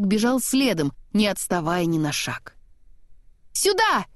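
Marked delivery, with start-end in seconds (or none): 3.5-4.1 high call. A narrator's reading. Anna calling Kristoff, high call. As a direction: call him over sharply. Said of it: "крикнула Анна".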